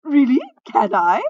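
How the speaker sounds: very loud, excited outburst; eager minstrel. A narrator's reading. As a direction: burst out with eager delight. Minstrel reacts eagerly to offer.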